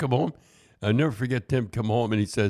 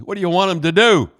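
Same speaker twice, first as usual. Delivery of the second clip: mock outraged wife, higher and louder; indignant retort; 7 semitones higher, 7 dB louder than usual. Wife defending her quarterback husband, imitated indignant outburst. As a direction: imitate a loud, exasperated defence.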